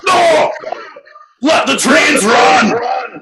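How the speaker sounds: high, loud, very slow Scottish bellow; Scottish father shouting. Retold or imitated. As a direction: roar it in a Scottish accent. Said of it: "everyone's now going Scottish".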